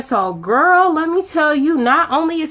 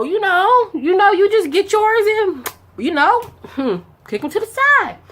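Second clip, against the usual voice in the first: high sassy aside; 9 semitones higher than usual. Retold or imitated. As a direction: pitch it up and sass it. Mock advice, cheeky.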